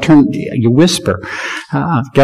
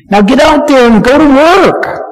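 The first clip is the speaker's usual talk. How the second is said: rousing command; 9 semitones higher than usual. Acted. Preacher sending congregation out.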